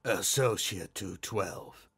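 low, slow masked man; low muffled reply, drawn out. A narrator's reading. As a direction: speak slowly as if through a mask. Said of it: "he responded with a muffled voice".

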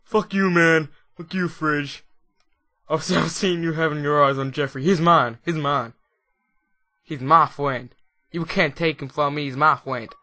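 loud put-on dog voice ranting; the Sims dog telling off the fridge in a put-on voice; voicing the dog. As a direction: play the jealous dog yelling at the fridge. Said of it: "It's like".

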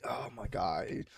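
ordinary talk

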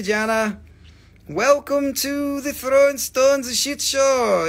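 normal talking voice

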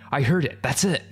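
lightly acted cry of discovery; alarmed cry. A narrator's reading. Frightened group spotting the creature, mild alarmed cry.

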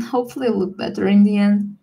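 normal talking voice

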